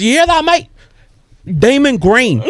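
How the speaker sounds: high, hammed-up Australian impression; "demon green" in broad mock Australian vowels; Australian accent. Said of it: "And then my Australian buddy goes".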